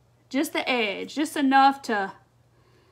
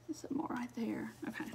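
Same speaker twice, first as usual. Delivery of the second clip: whisper; 17 dB quieter than usual. Real, not acted. Muttered aside while painting.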